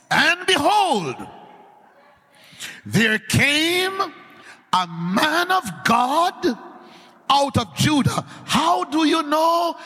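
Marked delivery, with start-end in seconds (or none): 0.1-8.3 slow, high, solemn reading of the text; scripture reading. Shown as a demonstration. Preacher reading scripture slowly to the congregation. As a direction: read the verse out slow and high. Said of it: "let me read it for myself".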